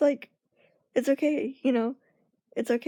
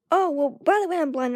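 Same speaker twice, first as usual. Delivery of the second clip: cheeky reveal; 4 semitones higher, 5 dB louder than usual. Retold or imitated. Springing the reveal on a stranger.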